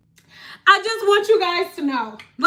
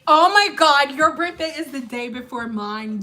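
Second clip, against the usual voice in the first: ordinary talk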